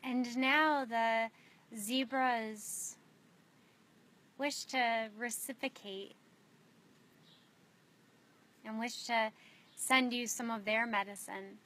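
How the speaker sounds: unhurried meditation guide with long pauses; spacious, measured guiding voice, letting each image settle; guided meditation. Real, not acted. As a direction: lead them gently inward, phrase by phrase.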